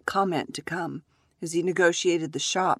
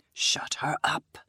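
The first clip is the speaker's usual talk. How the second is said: whispered menace; 3 semitones lower, 4 dB quieter, slower than usual. Acted. Angry husband at crying child.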